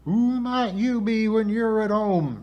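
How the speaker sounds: lightly hollowed, high-pitched questioning. A narrator's reading. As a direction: put on a high, hollow character voice. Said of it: "she inquired in her hollow voice".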